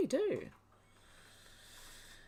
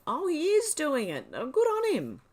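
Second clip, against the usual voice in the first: higher, louder, laughing disbelief; delighted surprise; 9 semitones higher, 6 dB louder than usual. Retold or imitated. Recalling clicking the link, laughs in delighted surprise. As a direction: replay the moment of finding out, thrilled. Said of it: "clicked on the link and went".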